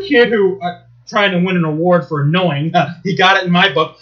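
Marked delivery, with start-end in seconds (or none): none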